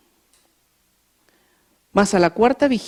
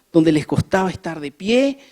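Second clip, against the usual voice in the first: ordinary delivery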